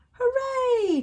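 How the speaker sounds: loud, high cheer. Acted. Mole cheering the tightrope walkers, loud high cheer. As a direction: cheer them on. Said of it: "cheered the mole".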